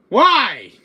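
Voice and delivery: loud, high wail of protest; exasperated cry. Acted. Exasperated demand over the nickname.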